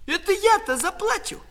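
high-pitched, scoffing comeback; high, taunting call; jeering retort. Acted. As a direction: jeer back at your sister from the tree. Brother jeers back at sister.

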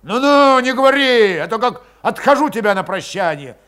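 loud, rising enraged abuse; barely holding back a blow; furious. A narrator's reading. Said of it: "взбесил его окончательно".

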